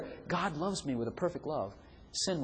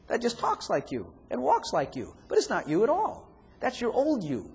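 normal talking voice

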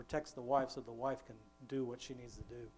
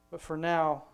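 slow, louder preaching build; 7 dB louder, slower than usual. Acted. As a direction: drive the last words home slowly. Preaching climax.

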